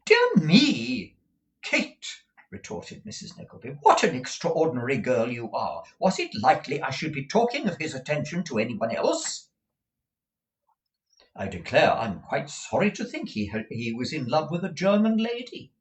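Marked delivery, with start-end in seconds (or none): 0.1-2.1 high, loud motherly retort; high-pitched exasperated outburst; exasperated exclamation. A narrator's reading. Mother retorts to daughter in amazement. 3.8-15.7 high, swooping motherly retort; emphatic high-voiced fussy mother; exasperated Mrs. Nickleby.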